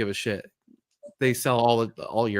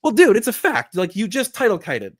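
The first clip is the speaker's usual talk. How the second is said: higher and louder, calling out the obvious; exasperated retort; 7 semitones higher, 6 dB louder than usual. Retold or imitated. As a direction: snap back at the seller, louder and higher. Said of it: "I'm like".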